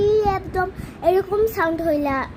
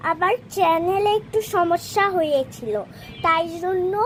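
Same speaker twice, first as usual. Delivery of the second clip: ordinary delivery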